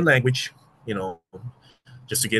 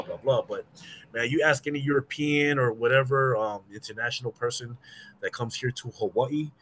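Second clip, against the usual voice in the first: ordinary talk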